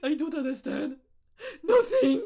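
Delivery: high, loud crying protest; mock tearful wail. Learner crying to her colleague, mock tearful wail. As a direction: wail it through exaggerated sobs. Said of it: "pourquoi tu pleures".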